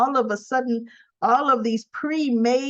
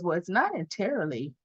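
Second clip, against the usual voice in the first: imitated coyness; 4 semitones lower than usual. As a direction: play it coy, low and halting. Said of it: "coy expression on her face".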